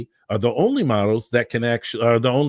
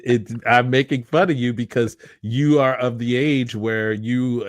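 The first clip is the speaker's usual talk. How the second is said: ordinary talk, nothing marked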